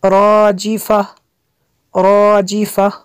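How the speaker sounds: heavy rounded r opening "राजिफा"; full-mouthed ra. Shown as a demonstration. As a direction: chant "राजिफा" with a full r and long first vowel. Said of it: "रा को पुर करना है".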